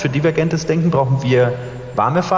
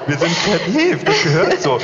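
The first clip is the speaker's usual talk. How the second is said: laughing; 5 semitones higher, slower than usual. Real, not acted. Mock-echoing partner, laughing.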